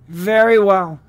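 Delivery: ordinary delivery, nothing marked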